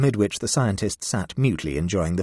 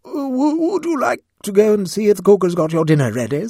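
very high, loud panicky hostess; panicked; 8 dB louder than usual. Acted. Lady Blemley desperately shooing the cat, panicked. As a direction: rush the cat away in panic. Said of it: "The panic which ensued".

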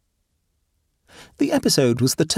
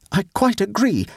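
retort; 11 semitones higher than usual. A narrator's reading. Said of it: "retorted the lady".

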